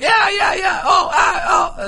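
high, loud shouted whoops; loud player whoops. Retold or imitated. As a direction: yell like a player after every shot. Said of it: "extremely loud and have to let you know every time they hit a shot".

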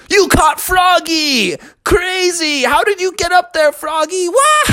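put-on a crowd of kids gasping in wonder; amazed kids.

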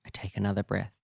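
plain reading voice

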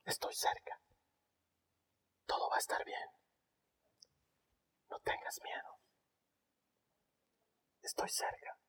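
tender unvoiced whisper; God whispering. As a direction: whisper comfort like a father. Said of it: "Le susurra".

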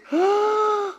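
high, voiced gasp; hopeful gasp. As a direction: gasp as hope dawns. Mary's sudden hope.

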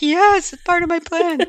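put-on high, loud giggly kid voice; squealing child. Mom imitates her daughter squealing under the tickles. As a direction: squeal the answer like a tickled little girl. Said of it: "She's like".

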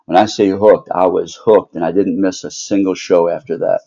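ordinary talk

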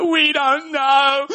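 high, slow whiny wail; mock crying kids. Kids answering where the Holy Spirit is, mock crying. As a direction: imitate children wailing back at their mum. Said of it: "And they were crying".

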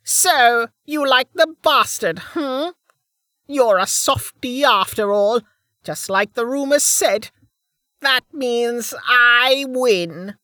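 high-pitched, wicked crone voice; gloating old woman. Acted. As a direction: crow over your opponent in a high crone's voice.

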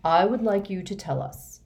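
plain reading voice

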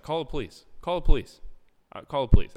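rising panic, shouted; mock yell. To the club staff, yelling for the police. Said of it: "I just keep yelling that".

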